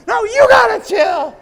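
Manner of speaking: high, loud comeback; shouting back at the roommate, excited. Retold or imitated. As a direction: fire it back at him.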